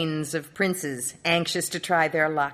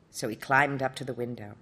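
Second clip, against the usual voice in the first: ordinary delivery, nothing marked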